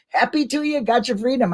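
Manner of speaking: high, gleeful party toast; mock toast. Celebrating a future exoneration with a mock cheer. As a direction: raise a pretend glass and cheer it out. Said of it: "get yourself a drink and say".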